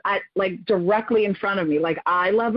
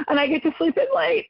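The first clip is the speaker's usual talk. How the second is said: higher, breathless joy after a gasp; a tired mom giddy at her first free weekend; overjoyed gasp; 9 semitones higher, faster than usual. Retold or imitated. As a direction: gasp then gush about sleeping in. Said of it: "you just wanna cry 'cause you're just so overjoyed".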